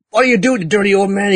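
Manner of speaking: high-pitched mock outrage; indignant scolding. Acting out outraged lady's scolding.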